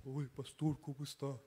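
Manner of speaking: low, soft mock-adolescent voice; put-on deep teenage voice; teen with changing voice. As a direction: imitate a teen trying to sound grown-up. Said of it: "todavía no les cambia la voz".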